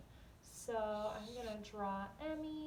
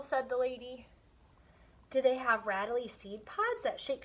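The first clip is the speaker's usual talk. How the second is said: lively storybook reading with voiced characters; story read aloud; 6 dB louder than usual. Teacher reading a picture book to young students, animated. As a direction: read it to the class with expression.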